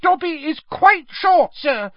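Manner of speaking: loud, very high squeak; high-pitched emphatic elf; earnest elf. A narrator's reading. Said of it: "said the elf earnestly".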